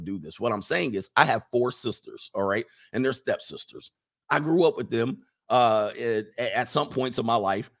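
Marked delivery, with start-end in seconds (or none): none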